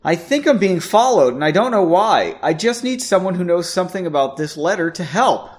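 high-pitched acted desperation; pleading. A narrator's reading. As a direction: plead for help in a rush of worry. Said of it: "Erin pleaded".

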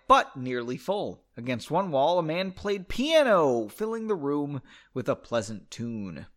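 ordinary delivery

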